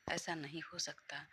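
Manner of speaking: soft unvoiced whisper to herself; whispered denial. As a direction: whisper it, refusing to believe. (खुद से फुसफुसाकर इनकार)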